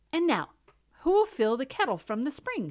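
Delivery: high asking voice; bright little hen. A narrator's reading. Little red hen asking for water, bright. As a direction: call out the next chore brightly. Cheerful hen asks again for help.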